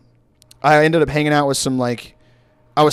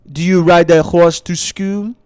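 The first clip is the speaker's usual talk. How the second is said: slow, louder mock French accent; 3 semitones higher, 5 dB louder, slower than usual. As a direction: ask it slowly as a French student struggling with English. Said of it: "the guy, like, switched to English".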